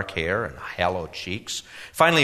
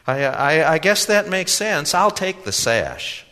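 put-on much higher, earnest young man; eager young man voice; 7 semitones higher, 5 dB louder, slower than usual. A narrator's reading. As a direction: naive youth, pitched up and louder.

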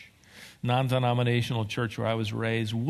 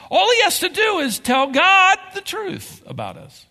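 fervent preaching; 8 semitones higher, 5 dB louder than usual. Acted. Preaching at full force.

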